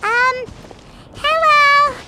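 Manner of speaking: timid hello. Acted. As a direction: shyly greet a stranger. Shyly greeting a stranger.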